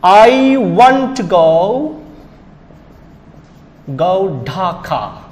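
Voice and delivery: very slow, drawn-out "I want to go, go Dhaka"; pronunciation demo. Shown as a demonstration. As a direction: spell out "I want to go, go Dhaka" by ear, word by word. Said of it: "When you pronounce that".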